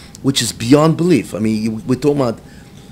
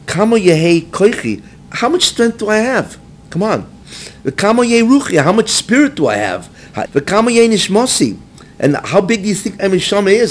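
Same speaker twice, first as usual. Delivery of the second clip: higher, pleading lament of a crushed man; crying out in anguish; 8 semitones higher than usual. Retold or imitated. Mashiach pleading with God under the weight, anguished high outcry. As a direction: cry out to God as if the weight is breaking you. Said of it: "He started crying and screaming".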